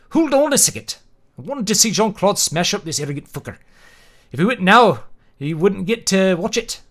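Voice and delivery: Scottish accent. Shown as a demonstration. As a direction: carry the narrator's Scottish voice through. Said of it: "trying to replicate a Scottish accent".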